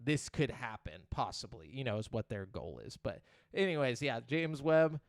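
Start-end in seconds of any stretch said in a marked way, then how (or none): none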